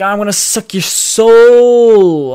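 slow, loud, high monster voice. Retold or imitated. Villain dragon threatening the heroes, slow loud monster voice. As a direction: stretch it out like a hammed-up soul-eating beast.

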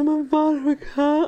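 lightly voicing a hurt kid's why; mock upset child. Mother voicing her hurt son, mild whine.

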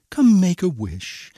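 storybook fairy's summons; crying out. A narrator's reading. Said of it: "she cried".

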